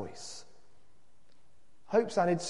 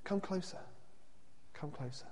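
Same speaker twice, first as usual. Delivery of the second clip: soft, coaxing invitation; hushed invitation; gentle beckoning; 14 dB quieter than usual. A narrator's reading. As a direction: beckon them in very softly. Man gently beckons the others.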